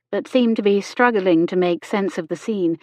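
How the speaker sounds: usual speaking voice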